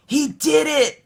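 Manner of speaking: put-on high excited child shout. A narrator's reading. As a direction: cheer like a thrilled little boy. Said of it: "Caillou shouted".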